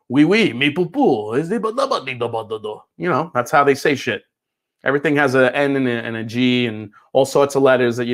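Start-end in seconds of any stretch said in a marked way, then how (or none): 0.1-2.8 hammed-up fake French gibberish, slowed down; goofing on how fancy the French sound; mock French. Joking about cognac spelling, in a silly mock French accent. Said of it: "The French are fancy".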